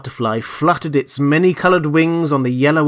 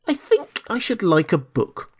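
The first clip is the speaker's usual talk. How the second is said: lightly voiced child, higher pitch; a touch of a little boy asking his nurse; young king; 6 semitones higher, 3 dB quieter than usual. A narrator's reading.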